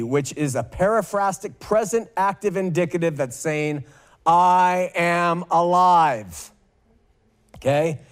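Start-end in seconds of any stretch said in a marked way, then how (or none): 4.3-6.5 slow emphatic quote. Acted. Quoting Jesus, drawn out.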